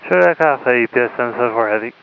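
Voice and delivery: radio-filtered pilot voice; speaking through a cockpit radio; pilot over the radio. Real, not acted.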